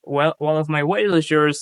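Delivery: usual speaking voice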